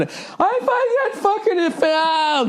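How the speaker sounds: very high, slow, laughing mock outrage; offended drunk soccer mom. Drunk soccer mom offended by the podcast clip, high laughing mock outrage. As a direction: ham up a tipsy, offended mom. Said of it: "drunk soccer mom at four in the afternoon".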